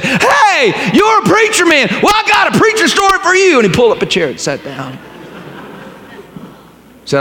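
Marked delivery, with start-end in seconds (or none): 0.0-3.6 high, loud, delighted shout; lit up old man. Acted. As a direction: call out to him with sudden joy. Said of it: "he just lit up and he said".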